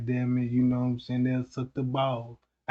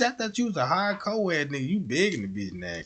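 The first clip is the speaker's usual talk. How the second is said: ordinary talk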